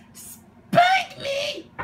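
tiny shrill mocking voice; squeaky taunt. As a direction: cheekily dare her. Squeaky voice mocks.

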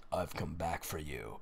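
very low, hushed menacing voice; ominous returning father. A narrator's reading. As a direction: drop deep and barely voice it. Returned dead father speaks in story.

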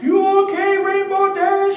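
very high, squeaky cartoon pony voice; Derpy voice. Shown as a demonstration. Panelist demoing a character's high squeaky line. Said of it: "And she talks like this".

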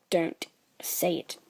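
soft unvoiced hush; urgent whisper. Real, not acted. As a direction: whisper to stop a friend. Hushing friend mid-joke.

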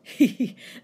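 short acted laugh; gloating snicker. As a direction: snicker at the rival's mishap. Gloating laugh inside the acted poem.

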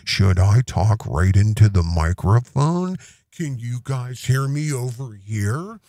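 a lightly voiced clueless host testing his mic; mock mic-testing newcomer. Mocking Owen fussing with his new microphone, close and low. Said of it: "Mister So Brand New with his microphone".

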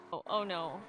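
normal talking voice

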